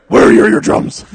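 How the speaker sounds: loud, high, raspy Batman shout; hammed-up Batman yell. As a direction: rasp it out as an overblown Batman. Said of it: "my favorite fucking Batman voice ever".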